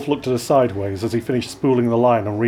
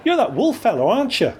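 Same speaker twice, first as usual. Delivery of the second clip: put-on childlike curiosity; 9 semitones higher than usual. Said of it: "with the guileless curiosity of a child".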